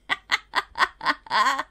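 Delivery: loud, real belly laugh; hearty uncontrolled cackle. Real, not acted. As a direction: burst out laughing, loud and helpless. Cracks up at toddler.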